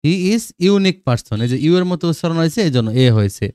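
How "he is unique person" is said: slow example sentence. Shown as a demonstration. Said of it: "আবার দেখো".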